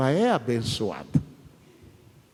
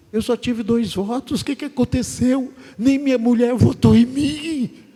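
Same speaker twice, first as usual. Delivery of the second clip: higher, louder, whining candidate lamenting his two votes; mock wounded complaint; 10 semitones higher, 6 dB louder than usual. Losing candidate bemoans his two votes, mock whiny and higher. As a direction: play the loser crying foul, pitched up.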